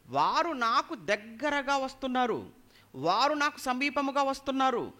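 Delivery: high, insistent preacher's cadence; impassioned preaching. Real, not acted. Preacher voices psalmist's complaint about enemies, preaching impassionedly. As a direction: pile up each clause, high and forceful.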